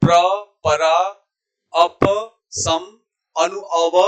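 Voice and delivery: each prefix separate, अप and अव with full final vowels; model prefix recitation. Shown as a demonstration. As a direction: recite one by one, अप as a-pa, अव as a-va. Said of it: "उपसर्ग कैसे बोलेंगे?". (हर उपसर्ग अलग, साफ़ उच्चारण)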